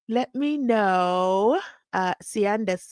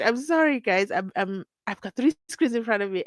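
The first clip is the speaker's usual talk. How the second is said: usual speaking voice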